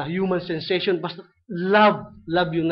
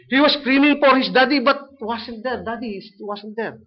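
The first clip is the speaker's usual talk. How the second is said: retold screaming; 6 semitones higher, 5 dB louder than usual. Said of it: "sabi niya".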